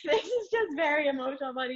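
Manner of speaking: high laughing through the words. Real, not acted. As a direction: laugh it off while explaining. Laughing through emotional wedding story.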